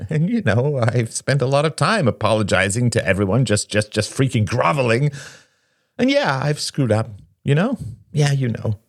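high-pitched, bitterly laughing tirade. A narrator's reading. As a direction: start on a bitter laugh and spill out the grievance. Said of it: "He laughed bitterly".

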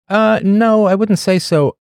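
high squeaky mock denial; mock high squeak. Answering a listener's worried question in a high squeak. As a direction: pitch way up in a jokey squeak.

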